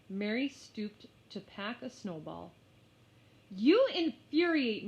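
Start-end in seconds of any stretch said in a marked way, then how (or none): 3.6-4.9 high, loud, slow anger; infuriated. Acted. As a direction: spit out your fury at the lamb. Mary angry at lamb.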